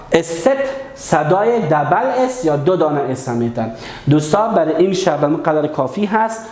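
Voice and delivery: ordinary talk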